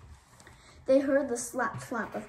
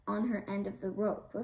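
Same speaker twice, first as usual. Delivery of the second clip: ordinary delivery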